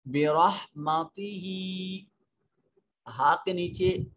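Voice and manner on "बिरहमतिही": बिरहमतिही slowly, the final ही drawn long; lengthened hi. Shown as a demonstration. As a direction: stretch the last ही of बिरहमतिही. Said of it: "इसको लंबा करेंगे". (बिरहमतिही में ही को लंबा खींचकर)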